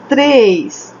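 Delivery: ordinary talk, nothing marked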